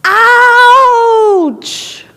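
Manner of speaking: loud high echo of the yell; the mountain echoing the child's cry. Acted. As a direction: throw it back like a mountain echo. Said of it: "the mountain yells back".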